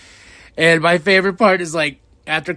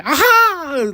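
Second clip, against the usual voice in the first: high, loud mock "aha"; silly drawn-out villain cry; imitating Ricochet; 13 semitones higher, 6 dB louder than usual. Heel wrestler doing his stupid line, mocking imitation. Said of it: "he does the stupid".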